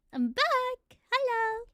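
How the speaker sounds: high, cheerful call; excited shout. Real, not acted. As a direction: greet the chat joyfully. Stream returns after crash.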